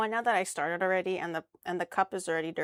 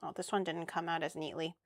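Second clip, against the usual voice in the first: ordinary talk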